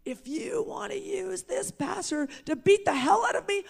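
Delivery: high, loud retold yell at God. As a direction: hurl the dare up at heaven again. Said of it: "And I yelled out to him".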